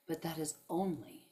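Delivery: usual speaking voice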